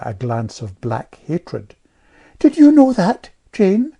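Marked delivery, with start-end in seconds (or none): 2.4-4.0 put-on high, louder piping girl; piping know-all child. A narrator's reading. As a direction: pipe up like a little know-all. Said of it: "piped Amelia".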